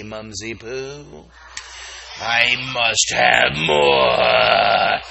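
very slow, loud creepy voice; monster growl. Pretend. Breakfast monster child telling mom, slow creepy growl. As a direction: drag out a hungry monster's demand.

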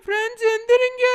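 high, loud alarm call; urgent shout. Acted. Leader wakes friends because of a snake, loud urgent shout. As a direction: shout to wake everyone.

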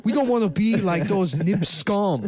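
halting mock foreign-accented speech; put-on candidate voice. Candidate speaking of how history will judge, mock foreign accent. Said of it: "chooses to sound like that".

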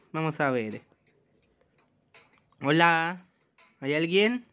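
usual speaking voice

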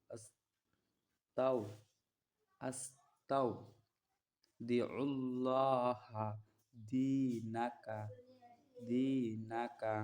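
stretched out syllable by syllable; slow Arabic recitation. Shown as a demonstration. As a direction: draw the word out for learners. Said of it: "আইন উচ্চারণ করে নিতে হবে".

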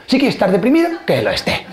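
mock washing your hands of someone; dismissive indifference. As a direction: pitch up on the condition, then drop it dismissively. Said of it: "pasan a la indiferencia".

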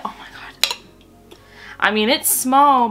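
normal talking voice

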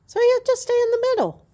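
fast, high and loud playful voice; high teasing voice. Real, not acted. Owner joking about her undecided horse, high fast teasing.

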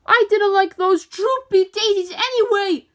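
high, loud, defiant holler; hollered back. A narrator's reading. Sheep hollering back at the queen bee, loud and defiant. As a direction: shout back, stung but proud. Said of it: "Violet hollered back".

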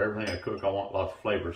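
usual speaking voice

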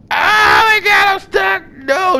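very high, slow, loud yell; panicked shout. Real, not acted. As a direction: scream in real alarm.

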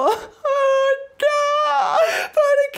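hammed-up fake sobbing wail, very high and loud; fake crying. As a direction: pretend to break down crying to win sympathy. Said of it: "me puse a llorar". (llanto fingido y exagerado, muy agudo y fuerte)

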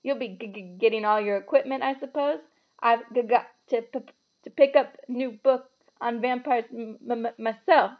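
halting stutter on "g-", "p-" and "m-"; nervous stammering. A narrator's reading. Terrified professor talking about vampire book, nervous stammering. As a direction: get stuck on first sounds, especially "m-, m-, myself". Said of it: "He laughed nervously".

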